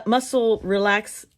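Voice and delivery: ordinary talk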